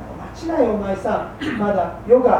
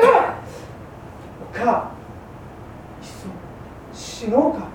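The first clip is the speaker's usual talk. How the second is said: hushed despair; 5 dB quieter, much slower than usual. Acted. Husband in despair.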